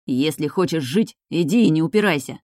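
ordinary delivery

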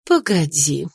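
put-on dry, curt old aunt. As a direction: stop her dryly. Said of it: "сухо проговорила вдовствующая герцогиня".

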